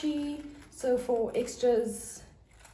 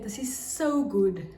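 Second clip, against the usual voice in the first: ordinary talk, nothing marked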